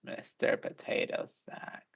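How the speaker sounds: slow, raspy, breathless whisper; Roz from Monsters Inc. Shown as a demonstration. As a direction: drag it out soft and raspy. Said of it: "It was a bit more like".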